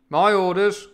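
high, loud assertion of command; captain's bark. Acted. Captain telling the men whose orders, shouting.

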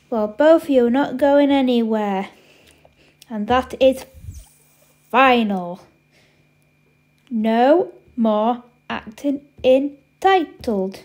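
emphatic big-sister telling-off, slowing down; firm, final scolding; stern command. Acted. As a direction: lay down the law, word by word. Big sister lays down the law.

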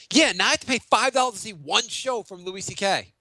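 ordinary delivery

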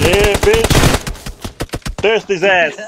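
triumphant yell; shouting orders over battle. Real, not acted. Shouting in a gunfight win.

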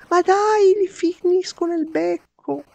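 very high desperate yell; exasperated shout. Real, not acted. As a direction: wail at the screen. Gamer misses again.

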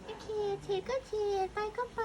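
high squeaky cat voice; voicing the cat. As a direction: answer for the cat in a tiny high voice. Voices the cat's answer.

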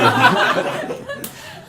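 sudden squealing laugh; bright real laugh; high burst of laughter. Real, not acted. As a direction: laugh high at own joke. Audience joke lands.

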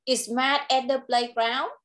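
"Is Matt at the playground?" lifts up on "playground"; slow, let the end climb; rising question tune. Shown as a demonstration. Teacher modelling a yes-no question for the child. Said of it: "con sẽ lên giọng ở cuối câu".

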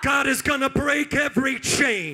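loud rousing preacher; preaching. Acted. Preacher stirring up the congregation, loud and rousing.

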